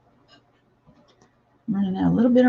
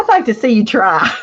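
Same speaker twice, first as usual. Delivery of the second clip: laughing tease; 4 semitones higher, 6 dB louder than usual. Real, not acted. Teasing viewer, laughing.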